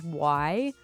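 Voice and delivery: ordinary delivery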